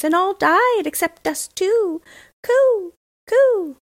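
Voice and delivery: high, loud bird voice with cooing calls; cooing wood pigeon. Acted. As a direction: chirp it out like a pigeon in a cage. Wood pigeons speak from cage.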